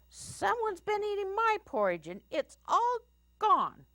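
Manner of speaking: very high little bear wail; baby bear voice. A narrator's reading. Baby Bear finds the porridge gone, high baby voice. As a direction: squeal it like an upset baby bear. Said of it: "he said in his baby bear voice".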